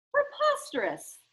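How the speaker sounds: high, haughty scoff; scoffing snob. Acted. Charles scoffs at the pine tree clue, haughty and high. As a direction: sniff it like a pompous snob.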